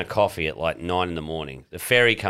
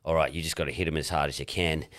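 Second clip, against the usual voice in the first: low serious coach voice; 4 semitones lower, 7 dB quieter than usual. Retold or imitated. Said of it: "He goes".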